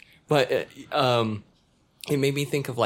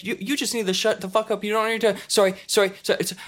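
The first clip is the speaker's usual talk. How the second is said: higher, hurried apologetic voice; gushing sorries like a polite Canadian; 8 semitones higher, faster than usual. Retold or imitated. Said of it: "apologizing all the time".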